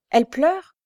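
high, loud, startled question; alarmed exclamation rising sharply; exclaimed question. A narrator's reading. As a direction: burst out in disbelief. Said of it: "s'exclama-t-il".

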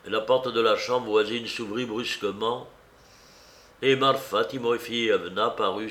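ordinary delivery, nothing marked

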